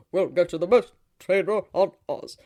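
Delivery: loud, jubilant father's voice; eagerly jubilant. A narrator's reading. Father promises his daughter a trainer, eagerly jubilant. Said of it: "Frex was eagerly jubilant".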